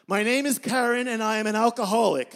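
high, loud, chirpy meeting voice; Karen at AA. Acted. Woman at the AA meeting announces herself loudly. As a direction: belt out the introduction.

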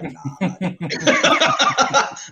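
squeaky drawn-out nah, laughing; high laughing refusal. Retold or imitated. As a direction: stretch it out high and giggly. Retold refusal, hammed up.

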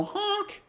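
a sudden high squeaky honk; rubber chicken honk. Pretend. On the disco floor to the crowd, a high chicken honk. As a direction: squeeze out a toy chicken's honk. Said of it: "get in the middle of this dance floor and go".

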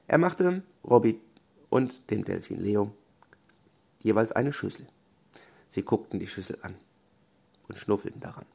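ordinary delivery, nothing marked

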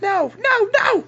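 very high falsetto refusal; high-pitched, distressed character voice; Clarice refusing. Acted. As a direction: squeal out each refusal in falsetto. Game master plays Clarice refusing.

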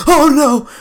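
hammed-up high gasp of horror; mock horror, pitched high; old-movie victim. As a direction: play terrified in an old melodrama.

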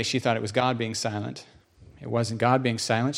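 ordinary talk, nothing marked

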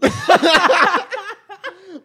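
loud, high burst of laughter; big embarrassed laugh; helpless laughing. Real, not acted. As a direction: crack up at yourself. Cracking up at own failed accent.

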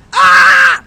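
one loud, high scream; shadow-boxing scream. Real, not acted. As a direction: let out a full-force fighting yell. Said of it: "shadow boxed like that".

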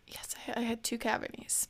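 hushed, whispered confession; very soft, reluctant whisper; sheepish admission. Real, not acted. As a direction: own up under your breath.

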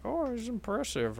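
lightly imitated onlooker, pitched high; a hint of mock admiration, voice lifted; Senator Palpatine. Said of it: "like, Senator Palpatine hanging out in the Jedi Temple".

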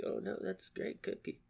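whispered, forced compliment; whispered fake praise. Guest praises a shitty cookie in a forced whisper. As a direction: hush it out like a polite lie. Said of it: "and you're like".

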